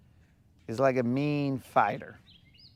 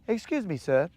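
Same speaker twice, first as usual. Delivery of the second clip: high, prim mock-polite voice; posh fish voice; 5 semitones higher than usual. Fisherman mocking a polite Canadian fish, high prim voice. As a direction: play a prissy gentleman fish. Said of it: "probably not in that accent".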